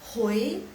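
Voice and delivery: HOI with a clear upward rise; second tone. Shown as a demonstration. Teacher showing how little is needed, rising second tone. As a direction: say HOI climbing in pitch. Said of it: "Hoi nada kedua".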